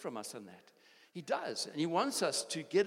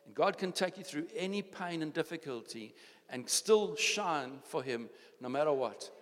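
ordinary delivery

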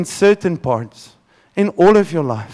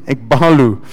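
ekbalu again, lower, in Afrikaans accent; 6 semitones lower than usual. Shown as a demonstration. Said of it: "I'm gonna say it now with my Afrikaans accent".

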